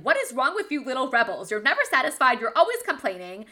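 a mom playing back her own yell at the complaining kids, half mocking; mock angry yell. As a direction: act out losing your cool with the little rebels. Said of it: "I'm yelling".

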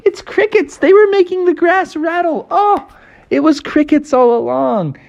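high-pitched reassuring frog voice; high, insistent little frog; Froggy character. Acted. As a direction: explain it in a high cartoon voice.

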